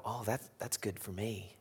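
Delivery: soft private satisfaction; smug aside. Pretend. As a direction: say it to yourself, pleased. Secret pleasure at rival's failure.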